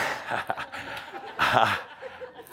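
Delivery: breathy, helpless chuckle; real laugh. Real, not acted. Speaker breaks off at cheesy pun, laughing. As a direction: crack up at your own joke.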